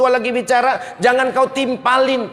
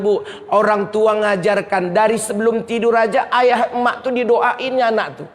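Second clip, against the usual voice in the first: ordinary talk, nothing marked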